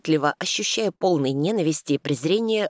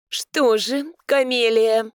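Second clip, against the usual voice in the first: condescending; 7 semitones higher, 4 dB louder, much slower than usual. A narrator's reading. Housekeeper speaks dismissively.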